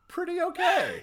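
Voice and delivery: high, far-off mock Scotty voice. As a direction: repeat it in a silly voice. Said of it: "I love that".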